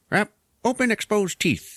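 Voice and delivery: high-pitched goofy skull-face voice; bared-teeth mimicry. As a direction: talk as if your lips were gone. Mimicking a lipless alien's bared teeth.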